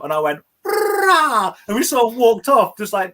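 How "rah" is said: high, sudden trumpet-like "rah"; blurted fanfare noise. Retold or imitated. Friend retelling his John Cena joke, high blurted fanfare noise. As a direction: burst out a high mock fanfare blare.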